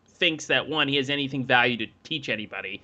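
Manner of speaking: normal talking voice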